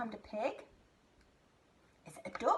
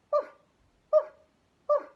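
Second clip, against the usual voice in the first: short, evenly spaced mock woofs; dog bark imitation; 12 dB louder than usual. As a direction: bark like a dog, one quick woof at a time. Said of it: "Listen again".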